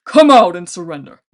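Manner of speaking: loud booming giant demand; commanding giant shout. A narrator's reading. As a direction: order her out like a warlord. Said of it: "he shouted".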